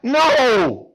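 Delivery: high, loud, desperate plea; pleading Moses. Retold or imitated. Moses pleading with God to refuse the task. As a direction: beg to get out of it. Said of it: "Moses says".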